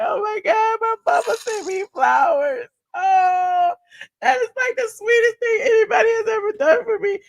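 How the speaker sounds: high, squeaky hammed-up tearful gushing; mock happy crying. Daughter overwhelmed by her mama's flowers, mock tearful.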